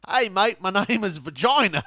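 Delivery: British attempt drifting into Australian; loud, high bad British-Australian accent; botched British accent. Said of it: "if some British person was like".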